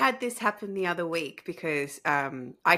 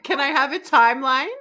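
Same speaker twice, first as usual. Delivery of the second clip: mock plea; 8 semitones higher, 8 dB louder than usual. Pleading with spirit.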